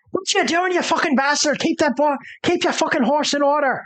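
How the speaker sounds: high-pitched, outraged scolding; angry townswoman. Acted. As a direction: scold a careless horseman in a high, indignant voice. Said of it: "she's like".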